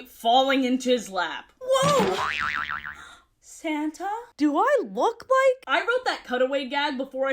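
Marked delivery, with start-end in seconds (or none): 1.6-5.6 high, loud startled yelp; hammed-up fall into a lap; cartoon surprise. Acted cutaway gag.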